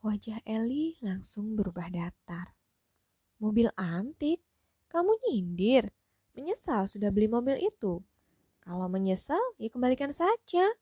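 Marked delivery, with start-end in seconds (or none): 3.4-10.7 louder, sharp and offended retort; curt, offended. A narrator's reading. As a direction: snap back, feeling insulted. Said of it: "Tukas Ibu Eli dengan raut wajah berubah ketus". (ketus dan tersinggung)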